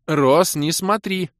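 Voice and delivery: high, urgent snarl; snarled command. A narrator's reading. Кира covers his eyes, snarling an order. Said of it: "рыкнула Кира".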